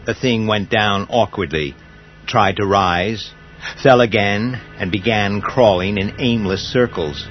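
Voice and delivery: ordinary delivery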